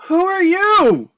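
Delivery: high, loud, slow demand; alarmed shout. Acted. Character confronts mysterious fortune teller.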